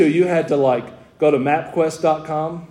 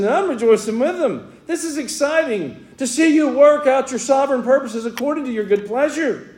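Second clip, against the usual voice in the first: raised, ringing preacher's voice, pitched well up; fervent preaching; 10 semitones higher than usual. Acted. Pastor preaching to his church, raised and fervent. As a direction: preach it out with full conviction to the congregation.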